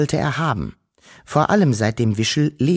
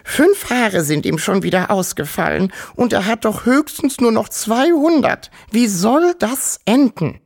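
high, squeaky lamenting granny; piepsende uralte Großmutter; 12 semitones higher, 3 dB louder than usual. A narrator's reading. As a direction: wail in a high old-granny squeak, drawing out the last question. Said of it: "piepste die uralte Wawuschel-Großmutter". (piepsig jammernde Großmutter)

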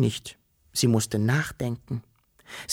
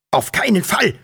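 higher-pitched, firm refusal; emphatic cry; 7 semitones higher, 3 dB louder than usual. A narrator's reading. Father forbidding Wischel to go on alone, calling out firmly. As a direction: call it out in protest. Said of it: "rief er".

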